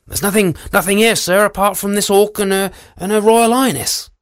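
high, hurried nervous guard. Acted. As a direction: blurt it out nervously.

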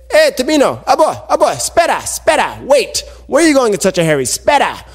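high-pitched call after someone hurrying by. Acted. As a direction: call urgently for him to wait. Waking uncle calls after rushing nephew.

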